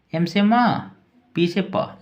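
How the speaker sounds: normal talking voice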